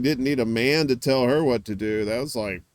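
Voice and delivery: normal talking voice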